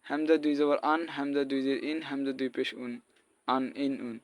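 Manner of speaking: আন, ইন, উন with light open vowels, run together; no throat squeeze on আন, ইন, উন; plain hamza. Shown as a demonstration. Teacher showing the confused letter, plain vowels in আন, ইন, উন. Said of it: "হামজা হয়ে যাবে".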